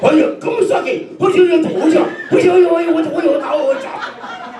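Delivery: mock cowboy yelling. Mocking student's ignorance.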